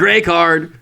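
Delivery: loud, high card call; referee penalty announcement. Pretend. Joke ref card call.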